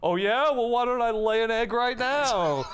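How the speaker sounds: high-pitched defiant mock rant; Mad Hatter screaming. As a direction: play a villain yelling a threat. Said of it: "while he's screaming".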